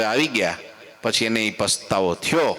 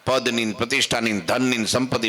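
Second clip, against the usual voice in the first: ordinary delivery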